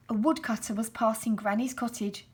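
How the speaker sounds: usual speaking voice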